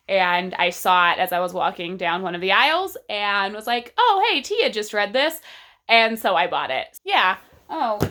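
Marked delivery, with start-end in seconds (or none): 4.0-5.4 raised, louder mock-spontaneous exclamation; perky realization. Recalling a bookstore find to viewers, perky realization. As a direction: act out a sudden pleased discovery. Quotes own sudden reaction in store.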